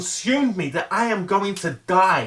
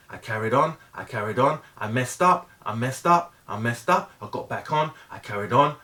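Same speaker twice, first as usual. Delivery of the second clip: usual speaking voice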